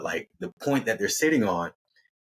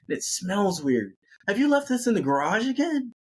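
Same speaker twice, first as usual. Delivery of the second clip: high-pitched put-on complaint; accusing question, swooping way up; hammed-up high disgust; fussy Amy voice; 10 semitones higher than usual. Amy complaining about jacket.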